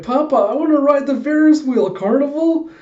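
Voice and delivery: put-on very high, loud child voice; excited little kid. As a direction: squeal a plea to papa like a kid. Child Anya begging her papa.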